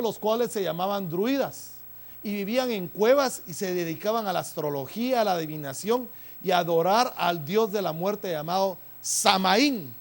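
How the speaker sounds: emphatic sermon delivery, key words driven hard; forceful preaching. Real, not acted. Preacher telling congregation of the druids' god, forceful preaching. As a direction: preach it out, building to the name.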